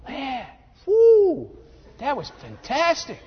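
hammed-up theatregoer raving; mock gushing audience. Audience gushing after the play.

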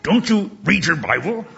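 old teacher scolding students, lightly voiced; imitating Doctor Ebling. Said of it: "He would say".

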